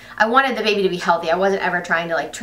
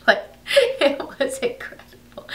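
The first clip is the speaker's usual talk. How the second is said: laughing through a breathy whisper; 7 semitones higher than usual. Real, not acted. Recalling the sweaty birth scene, whispered laughter. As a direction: giggle it under your breath.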